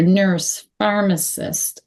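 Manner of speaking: ordinary delivery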